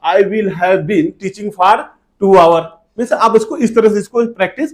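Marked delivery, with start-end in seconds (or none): 0.1-2.6 slow model sentence. Shown as a demonstration. Teacher models English sentence. (बहुत धीरे-धीरे, अंत में ज़ोर से)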